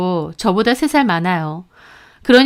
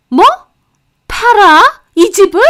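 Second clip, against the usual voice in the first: much higher, louder, aghast; shocked outburst; 10 semitones higher, 7 dB louder than usual. A narrator's reading. Mother-in-law learning the house was sold, shocked outburst. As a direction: gasp out each word in disbelief.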